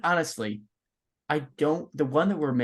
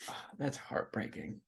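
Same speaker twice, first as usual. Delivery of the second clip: whispered; 13 dB quieter, slower than usual. Pretend. Mock grief over ranking.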